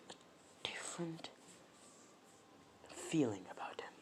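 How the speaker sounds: hushed, secretive whisper. Acted. Toad confiding to Jiraiya about the kid, whispered. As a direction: lean in and breathe the words.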